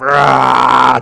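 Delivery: loud hammed-up roar; mock roar. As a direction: roar like a pretend monster. Mocking scary cat claim.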